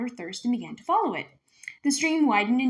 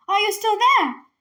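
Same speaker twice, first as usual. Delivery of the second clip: much higher, pleading question; plaintive; 12 semitones higher, 9 dB louder than usual. Acted. Trapped dragon to the girl, plaintive. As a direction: ask anxiously, afraid of being left. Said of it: "the voice asked plaintively".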